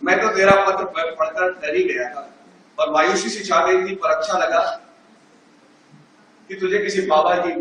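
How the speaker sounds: ordinary talk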